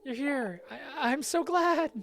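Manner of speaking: high, breathless relief at seeing Sonny; relieved character voice. Acted. Greeting Sonny after fearing he was gone, high and relieved. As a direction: sound overjoyed he came, high and slow.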